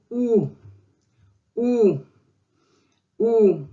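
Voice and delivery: "u" said as a long, separate oo sound; vowel demonstration. Shown as a demonstration. Teacher introduces the next vowel to learners, demonstrating "u" as oo. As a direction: hold each "u" as a pure oo, with a pause between. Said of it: "that takes us to the next vowel".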